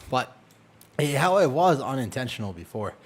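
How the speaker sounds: ordinary delivery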